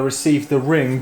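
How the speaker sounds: normal talking voice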